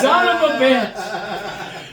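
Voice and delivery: loud, high, long-drawn curse; hammed-up exasperation. Driver threatened by Billy before the test, hammed-up exasperation. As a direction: stretch the curse out, mock outraged.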